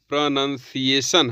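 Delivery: प्रोनंसिएशन said pro-nun-see-ay-shun, no ow; प्रोनंसिएशन the correct way; correct pronunciation. Shown as a demonstration. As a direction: say प्रोनंसिएशन with nun, not noun. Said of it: "सही उच्चारण".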